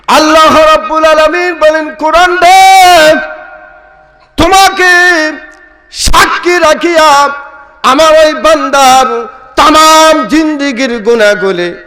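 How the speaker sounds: ringing, high-pitched preacher's cry; preaching at full force. Acted. Preacher voices God forgiving His servant, high impassioned proclamation.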